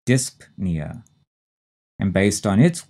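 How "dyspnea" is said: "dyspnea" with a break after DISP; P clearly pronounced. Shown as a demonstration. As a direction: sound the P in "dyspnea": DISP, nee-uh. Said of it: "we say that P".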